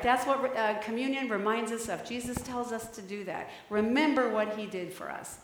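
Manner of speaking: ordinary delivery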